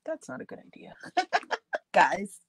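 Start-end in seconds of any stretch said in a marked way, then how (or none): 0.0-0.9 whisper. Real, not acted. Aside to viewers while crafting.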